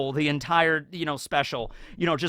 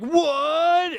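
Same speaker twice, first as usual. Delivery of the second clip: higher, louder cartoon gasp; shocked Marines; 12 semitones higher, 3 dB louder than usual. Marines realizing it was a fan letter, shocked yelp. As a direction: yelp it in disbelief.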